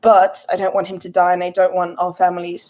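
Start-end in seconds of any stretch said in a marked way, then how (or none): none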